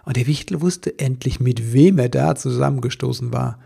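ordinary delivery, nothing marked

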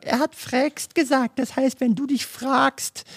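very high, drawn-out mocking voice; squeaky-high slow parody of commenters; outraged online commenters. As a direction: mimic nitpickers going loco, way up high. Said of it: "TikTok und Konsorten gehen loco und sagen".

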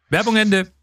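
high-pitched sign-off call; ad end announcement. Acted.

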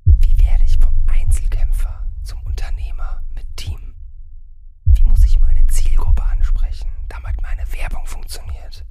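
secretive breathy whisper throughout. Acted. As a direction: whisper each question like a secret. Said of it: "Aber warum flüsterst du denn?".

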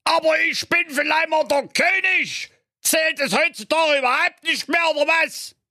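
hohe, gespielt brüllende Königsstimme; empört losschreien; gebrüllt. Acted. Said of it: "brüllte". (der König brüllt beleidigt seine Tochter an)

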